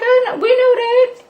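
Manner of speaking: squeaky, sarcastic "we knew that"; high mocking squeal. Reacting to Dina siding with Michael, a high mocking squeal. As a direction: pitch it way up and mock her. Mocking Dina's obvious betrayal.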